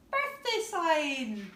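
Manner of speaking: ordinary delivery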